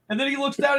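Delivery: ordinary talk, nothing marked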